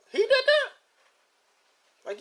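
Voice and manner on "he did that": very high, incredulous squeal; imitated astonishment. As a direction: act out a fan's amazed question. Voicing a surprised viewer.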